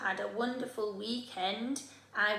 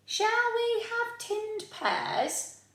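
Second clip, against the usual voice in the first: much higher, slow mum voice; Mum asking; 12 semitones higher, slower than usual. Acted. Mum offering lunch to Oliver, high and slow. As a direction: offer it sweetly as a mother. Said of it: "she asked".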